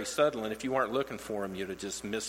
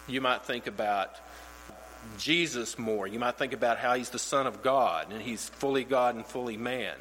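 ordinary talk, nothing marked

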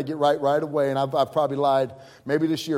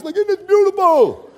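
put-on much higher, louder Italian-accented gush; Italian impression; 14 semitones higher, 10 dB louder than usual. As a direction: gush over the view like an excited Italian pastor. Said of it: "You know, those Italians".

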